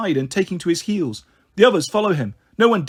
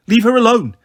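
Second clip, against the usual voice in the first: loud, raised protective shout; higher and louder, indignant; 6 semitones higher, 6 dB louder than usual. A narrator's reading. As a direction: shout at him to back off. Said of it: "Dan shouts".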